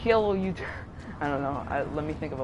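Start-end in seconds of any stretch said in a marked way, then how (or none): 0.0-0.7 hammed-up Russian accent; Russian accent impression. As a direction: greet your viewers like a Russian vlogger. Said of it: "my Russian guy".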